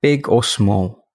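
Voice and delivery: unhurried guided reflection, leaving space after each phrase; guided meditation. Acted. Podcast host guiding listeners through end-of-day review, calm meditation voice.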